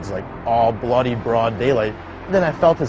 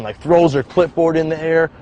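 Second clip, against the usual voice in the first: usual speaking voice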